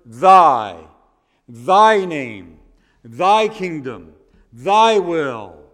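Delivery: thy punched loud each time; stress on thy. Shown as a demonstration. Pastor drilling the stressed word for the congregation, stressed thy. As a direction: hit thy hard, name, kingdom, will. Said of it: "we emphasize that word".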